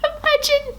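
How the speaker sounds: high squealing laugh through the words; can barely get it out for laughing; laughing hard. Real, not acted. Reading a fan comment, breaks into squealing laughter.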